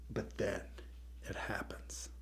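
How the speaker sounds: soft whispered "it happens"; ominous hushed storyteller beat; suspense whisper. A narrator's reading.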